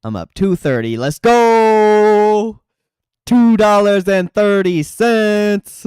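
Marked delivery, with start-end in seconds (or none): 1.0-2.6 loud, high cheer; big excited yell; hyped shout. Real, not acted. Hyped about stock going up. 3.3-5.8 high, slow, drawn-out mock proclamation; hammed-up slow declaration; mock announcer. Joking about small gain.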